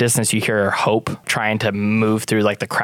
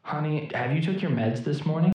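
higher, softer country character voice; villager husband; 5 semitones higher, 8 dB quieter than usual. Acted. Husband asks about the morning meds, soft and high. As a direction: speak higher and softly, as from a distance.

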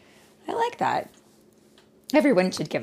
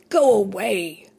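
put-on a firm, slightly raised "go away" from old Noah; Noah crying out; 3 semitones higher, 5 dB louder than usual. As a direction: push the giant off sharply. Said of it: "cried Noah".

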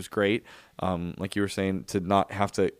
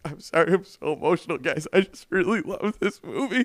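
put-on higher, choked-up apology to the kids; teary apology; 9 semitones higher, 4 dB louder than usual. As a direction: apologize through happy tears. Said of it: "I was just like".

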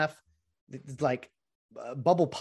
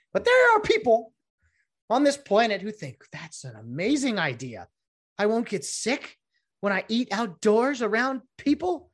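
mocking disbelief; 9 semitones higher than usual. Mocking people who like pods.

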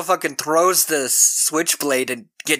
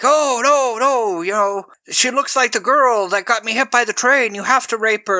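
high, louder mock voice of a creepy old man; mock old man egging on; 9 semitones higher, 4 dB louder than usual. Podcaster retells the old man goading Vegetable in a high, loud mock voice. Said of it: "egging him on".

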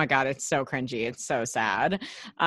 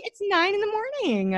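disbelief; 14 semitones higher, much faster than usual. Retold or imitated. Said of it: "I was like".

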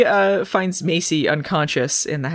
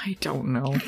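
whisper; 5 semitones lower, 8 dB quieter than usual. Real, not acted. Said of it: "whispered aside".

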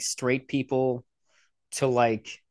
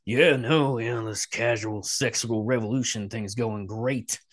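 put-on voice, sinking lower at the end; mock casual endorsement; 4 semitones lower than usual. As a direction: parody someone blandly approving. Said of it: "No one is out here going".

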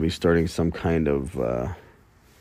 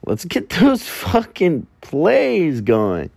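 much higher, louder and slow, a mock battle cry; hyped-up rally cry; 10 semitones higher, 5 dB louder, much slower than usual. Podcaster rallying listeners to stream his song, mock hype. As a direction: pump up the crowd.

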